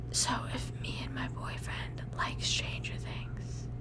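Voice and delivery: soft breathy whisper. Shown as a demonstration. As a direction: whisper it close. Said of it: "so I have to whisper like this".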